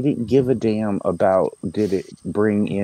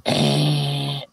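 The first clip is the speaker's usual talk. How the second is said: short mock buzzer noise, pitched up; a quick buzzer blurt, like a quiz-show buzzer; imitated game-show buzzer; 6 semitones higher than usual. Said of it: "because you go".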